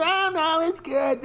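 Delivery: high, whiny mock defence of the indicator; mocking critics. Trader mocking imagined defenders in a high, whiny voice. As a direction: sneer at the people who'll disagree. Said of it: "there'd be a hundred people that's".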